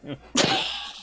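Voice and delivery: squeaky high laugh. Real, not acted.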